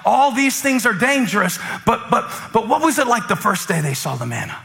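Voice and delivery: energetic preaching. Real, not acted. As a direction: preach it with rising urgency. Preaches with raised pitch and energy.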